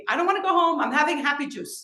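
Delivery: a son acting silly on the holiday; funny drunk voice. Son joking to mother, tipsy and playful. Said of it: "he'd love to say things in funny ways".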